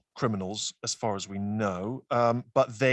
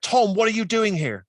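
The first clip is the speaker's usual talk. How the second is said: retold shocked homeowner, higher and louder; astonished challenge; 9 semitones higher, 6 dB louder than usual. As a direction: act out catching someone you know red-handed. Homeowners catch burglar neighbour, retold.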